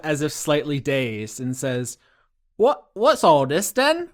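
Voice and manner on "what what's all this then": put-on lightly done old London copper; a hint of a cockney policeman; British bobby. GM voicing dazed Marina as a mild London bobby. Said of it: "only thing I can say in that voice".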